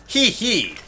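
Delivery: high-pitched spoken hee-hee; put-on giggle. Player voicing a game character's written laugh, put-on high giggle. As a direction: read out a dainty fake giggle.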